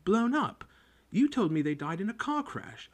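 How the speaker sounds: high, stunned question; can't believe what he just heard; shocked disbelief. Acted. Harry to his aunt, stunned disbelief.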